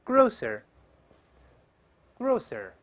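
grocer said very slowly, with a long pause for repeating; model word reading. Shown as a demonstration. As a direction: say "grocer" slowly and loudly, twice. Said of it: "我们读一下这个词".

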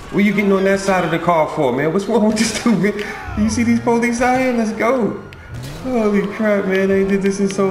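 exasperated yelling. Real, not acted. Yelling at partner, police near.

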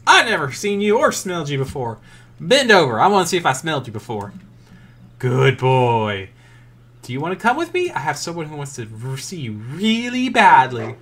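loud, fast goofy dog voice; voicing the dog. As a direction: blurt it out as the confused dog. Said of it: "the dog's like".

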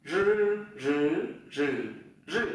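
"Rư" buzzed with tongue tip curled, through four Mandarin tones; curled-tongue voiced r. Shown as a demonstration. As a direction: curl up and voice each "rư", changing tone. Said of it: "Đầu lưỡi cuộn lên này". (đọc "rư" cong lưỡi, rung dây thanh)

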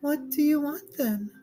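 very high, impatient princess. Acted. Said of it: "the princess asked".

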